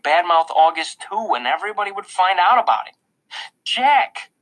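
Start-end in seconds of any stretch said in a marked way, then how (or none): 3.6-4.3 scolding. A narrator's reading. Mother scolds silent son.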